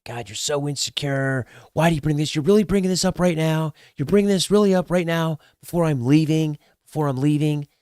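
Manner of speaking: high-pitched mock complaint; exasperated girlfriend. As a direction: imitate a girlfriend whining in exasperation. Girlfriend's exasperated reply, retold.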